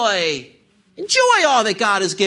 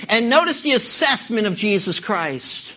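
ordinary talk

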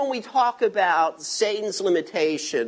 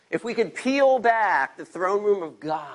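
normal talking voice

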